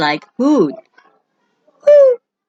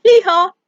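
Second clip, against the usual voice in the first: high, loud hee-haw; donkey bray; 12 semitones higher, 5 dB louder than usual. Shown as a demonstration. Teacher showing what a donkey says, braying. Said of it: "A donkey says".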